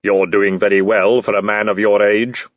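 level, booming supernatural figure; Death. Acted. Death addressing the man in the tree, solemn intoning. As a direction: intone it evenly, without hurry. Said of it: "Death was sitting".

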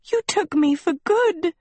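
high, wailing, hammed-up sob; tearful high-pitched complaint; sobbing. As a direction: sob it out as a grievance. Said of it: "Sophie sobbed".

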